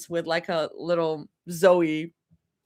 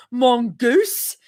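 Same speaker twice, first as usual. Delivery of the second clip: imitated British actress; mock-British accent, slightly higher; 4 semitones higher, 5 dB louder than usual. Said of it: "she said in a very British accent".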